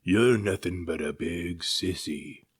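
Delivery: slow, scornful shark voice; slow sneer from a bully shark. Acted. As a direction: look down on him with contempt. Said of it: "sneered the shark".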